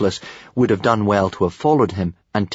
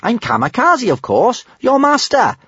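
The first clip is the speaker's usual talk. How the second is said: very high, indignant surprise; surprised; 4 dB louder than usual. A narrator's reading. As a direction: insist on it, pitched high with disbelief. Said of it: "said Kamikaze in surprise".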